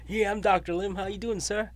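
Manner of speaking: high, bright Southern greeting; playing up a Southern accent for a caller from Florida. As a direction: lay on a Southern accent, pitched high, like being back home. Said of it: "I said, you know, with a southern accent".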